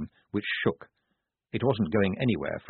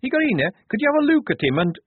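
high, loud, rushed request; fast, fretful plea; nervous; 14 semitones higher, 6 dB louder, faster than usual. A narrator's reading. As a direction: hurry it out anxiously at high pitch. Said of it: "Shufti began nervously".

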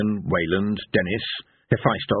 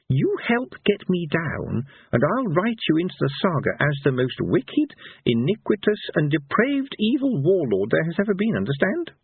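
bargaining minstrel; 8 semitones higher than usual. A narrator's reading. Minstrel promising a villain fame in the saga, insistent. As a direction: press the terms grandly on the listener.

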